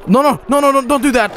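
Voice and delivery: panicked. Real, not acted. Panicking at the game.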